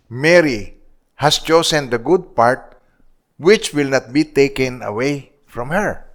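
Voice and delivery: slow, deliberate reading of the verse; slow scripture reading. Acted. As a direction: lay each word down slowly. Preacher reading scripture slowly. (mabagal na pagbasa ng talata)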